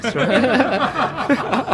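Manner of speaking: high laugh through the words; laughing at the joke. Real, not acted.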